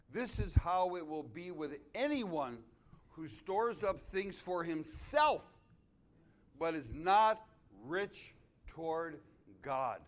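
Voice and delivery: slow, loud and high, hammering each word; loud, slow scripture preaching. Real, not acted. Pastor reading Jesus' warning aloud, slow and booming. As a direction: proclaim the verse with weight.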